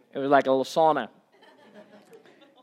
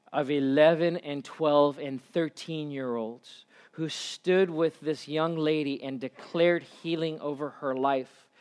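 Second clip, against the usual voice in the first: ordinary talk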